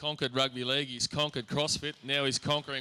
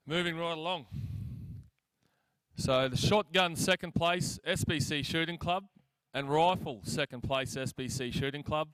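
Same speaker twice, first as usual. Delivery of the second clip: awards announcement; 3 semitones higher, 5 dB louder than usual. Acted. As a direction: announce each prize clearly. Reading out award winners.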